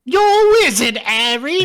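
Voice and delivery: high, loud put-on British Hagrid voice; mock Hagrid. Doing Hagrid to his friend, high and loud. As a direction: ham up Hagrid's big line.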